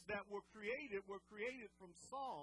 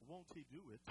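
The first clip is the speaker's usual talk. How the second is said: limp, quiet version; 7 semitones lower, 7 dB quieter, faster than usual. Shown as a demonstration. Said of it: "It's, it's not".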